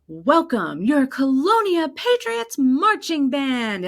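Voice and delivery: high, loud, slow stadium announcer voice; dramatic announcer. Retold or imitated. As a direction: announce the band with extra drama. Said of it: "I put such inflection in my voice".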